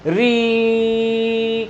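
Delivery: very slow, री stretched into ई; drawn-out री. Shown as a demonstration. Teacher stretching री to show the ई ending. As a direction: let री melt into a long ई. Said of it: "री का उच्चारण जब दीर्घ स्वर में".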